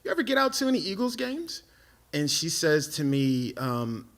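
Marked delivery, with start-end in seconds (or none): 0.0-1.6 high, hesitant, then hurried; nervous invitation. Retold or imitated. Nervous man asking the girl about Eagles games, timid. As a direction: squeak out a timid ask.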